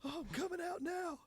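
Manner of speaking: high, urgent panicked voice. Real, not acted. Bitten diver calling up that he's coming out, panicked. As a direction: get out fast, scared and high.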